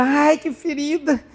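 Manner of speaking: slow, whiny complaint of pain; mock whining. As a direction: whine about the wound, hammed up.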